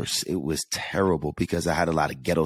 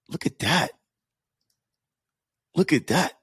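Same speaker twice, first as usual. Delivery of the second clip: higher, delighted look-at-that, twice; amazed delight; 10 semitones higher than usual. Real, not acted. Marveling at a listener's weight loss.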